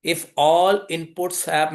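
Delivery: very slow, word-by-word delivery; slow dictation. Real, not acted. Teacher giving the class a definition, slow dictation. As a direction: spell out a rule one word at a time.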